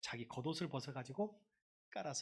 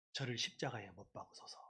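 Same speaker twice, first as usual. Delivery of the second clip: subdued; 5 semitones lower, 6 dB quieter than usual. Shown as a demonstration. First, subdued reading of crowd's cry.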